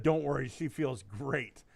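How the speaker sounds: usual speaking voice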